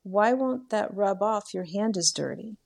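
lightly voiced little boy, innocent. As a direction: ask it like a curious, innocent small child. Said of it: "in the most innocent voice".

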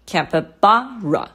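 slow capybara demo, peak on BA; stress on third syllable. Shown as a demonstration. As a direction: stretch the BA of capybara, then drop. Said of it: "最高音的音节是在ba".

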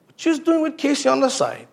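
curious coworker. Retold or imitated. Coworker finally asks Mike what he was doing, high and curious. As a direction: ask it like a nosy coworker. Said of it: "coworker finally asks".

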